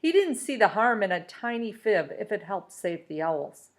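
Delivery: ordinary delivery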